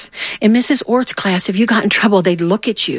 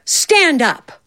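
a hint of a stern teacher, pitched higher; 7 semitones higher, slower than usual. Teacher catching a chatting student in class, a hint of sternness. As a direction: call out the name sharply, lightly voiced. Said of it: "And she said".

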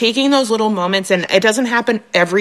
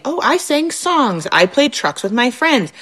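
put-on higher, slow and sweet like a two-year-old; toddler voice; 5 semitones higher, slower than usual. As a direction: chirp it out like a little child. Two-year-old's answer, voiced by mom.

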